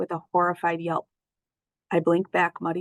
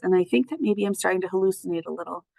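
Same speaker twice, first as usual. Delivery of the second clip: ordinary delivery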